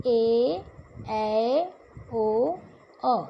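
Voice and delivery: "ए, ऐ, ओ, औ" each held for double length; drawn-out "ए, ऐ, ओ, औ", one by one; long vowels. Shown as a demonstration. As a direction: stretch each of "ए, ऐ, ओ, औ" on its own. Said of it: "दो गुना मतलब double time".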